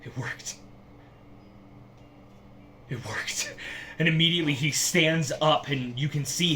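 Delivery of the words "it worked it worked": shaky awe. Acted. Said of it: "his hand is shaking as he looks up and goes".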